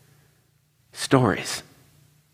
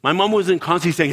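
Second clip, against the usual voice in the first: ordinary talk, nothing marked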